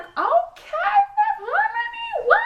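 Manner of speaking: very high, slow stretched shout; hyped shout. Retold or imitated. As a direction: scream it like a fan at the stage. Hyped when Fifth Harmony came out.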